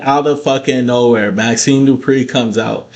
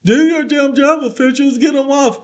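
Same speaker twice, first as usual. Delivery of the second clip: much higher, mock-shrieking commentator; hammed-up squeal at the officials; mocking Michael Cole; 12 semitones higher than usual. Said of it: "Michael Cole's gonna sit there and say".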